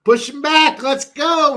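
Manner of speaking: loud fan cheer; shouting. Real, not acted. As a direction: yell at the screen. Cheering the defense.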